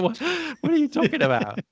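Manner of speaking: high, fast incredulous squeak. Retold or imitated. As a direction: react in total disbelief. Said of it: "I go".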